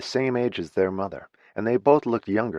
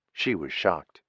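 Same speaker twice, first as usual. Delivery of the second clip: plain reading voice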